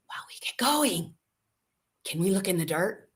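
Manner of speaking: put-on soft whisper of a little boy; whispered request. Quincy asking his grandmother to look in the dirt, soft whisper. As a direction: hush it like a shy secret. Child asks quietly from couch.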